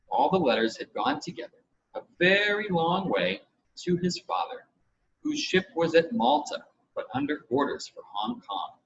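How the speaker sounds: plain reading voice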